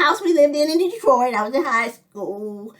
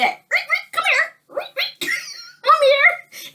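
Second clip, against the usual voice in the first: very high squeaky parrot voice; parrot calling dog; slower than usual. Retold or imitated. The bird calling the dog Rick, very high squeaky parrot voice. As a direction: squawk it like a parrot. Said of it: "the bird said".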